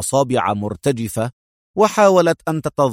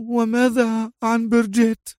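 choked murmur; 10 semitones higher than usual. Acted. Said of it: "تغمغم في صوت مختنق".

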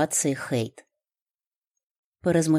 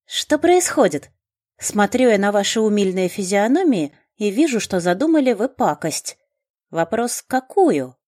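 suspicious heroine; 6 semitones higher, 5 dB louder than usual. A narrator's reading. Хейт suspicious of friends.

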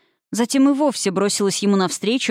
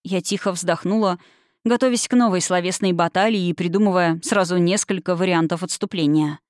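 ordinary delivery, nothing marked

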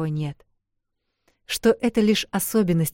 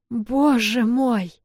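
slow, drawn-out higher cry; shocked cry; 7 semitones higher, 3 dB louder, much slower than usual. Acted. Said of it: "закричала Эл, поражённая до глубины души".

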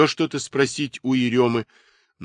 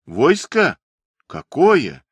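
high, puzzled question; genuine bewilderment; 5 semitones higher, 4 dB louder, slower than usual. A narrator's reading. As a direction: sound truly baffled. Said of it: "с неподдельным недоумением".

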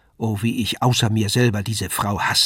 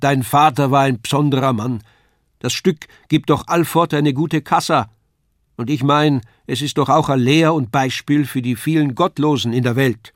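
raised, loud dialect argument; calling across; 4 semitones higher, 6 dB louder than usual. A narrator's reading. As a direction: defend the play across the hall. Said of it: "rief Herr Tendler von der anderen Wand".

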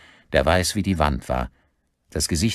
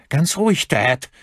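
high, urgent appeal to calm down; high-pitched anxious calming; alarmed plea; 10 semitones higher, 4 dB louder than usual. A narrator's reading. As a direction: plead nervously in a raised pitch. Son pleads with armed father.

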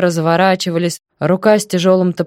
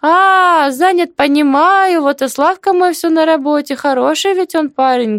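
very high, raised village-aunt voice; kindly neighbour, pitched right up; chatty deaf auntie, understanding; 10 semitones higher, 3 dB louder than usual. Acted. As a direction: prattle on in a high voice. Story figure: hard-of-hearing aunt.

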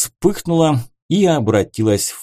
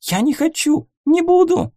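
frightened cry. Acted. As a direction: scream the refusal through tears. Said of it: "испуг в её голосе".

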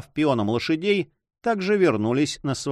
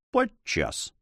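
usual speaking voice

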